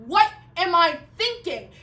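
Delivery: loud, high-pitched panicked self-questioning; freaked out. Acted. Woman who just kicked the neighbors' dog, freaked out. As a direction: blurt it out loudly, freaking out at yourself. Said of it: "I totally freaked out".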